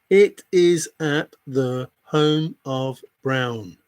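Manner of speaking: each word spaced out; slow, deliberate repeat. Real, not acted. Repeating the answer to a doubting viewer, slowly and pointedly. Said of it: "repeats answer slowly for emphasis".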